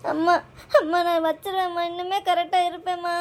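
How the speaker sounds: tearful, whining promise; crying plea. Acted. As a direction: beg mum through tears to let it go. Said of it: "என்ன அழுக?". (அழுதுகொண்டே கெஞ்சும் குரல்)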